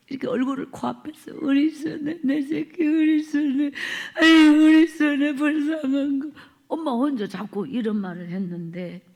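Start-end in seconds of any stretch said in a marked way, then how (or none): 1.4-6.3 higher, sing-song grieving lament; keening lament. Retold or imitated. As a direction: let it rise into a wailing chant.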